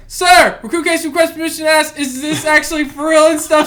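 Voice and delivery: high, quick formal request; recruit addressing instructor. Retold or imitated. Recruit asking instructor if this is real, in high formal recruit voice. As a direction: call it out like a recruit on parade. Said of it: "I was like".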